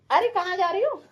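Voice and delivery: calling out. Real, not acted. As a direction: call her back as she walks off.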